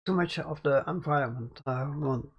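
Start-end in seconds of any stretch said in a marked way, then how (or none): none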